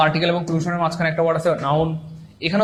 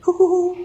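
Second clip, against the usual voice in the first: very high, hooting mock laugh; imitated hoo-hoo laugh; 13 semitones higher, 3 dB louder, slower than usual. Teacher acting out a student's laughing reaction, mock hoo-hoo laugh. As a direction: hoot a pretend laugh far above your usual pitch. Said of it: "হাসি দিয়েছেন".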